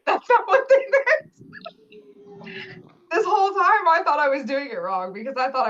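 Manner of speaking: laughing through the words; high giggly laugh while talking. Real, not acted. Laughing at her own mistake.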